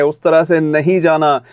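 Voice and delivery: ordinary talk, nothing marked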